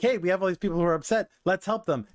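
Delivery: high, fast mock plea; lightly voiced employee begging the boss; a hint of a worried assistant; imagined assistant pleading. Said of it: "an imaginary scenario".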